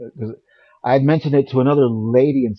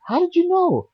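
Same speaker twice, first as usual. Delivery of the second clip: very high-pitched retold amazement; astonished. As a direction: act out her astonished question, pitch way up. Retold astonishment at her knowledge.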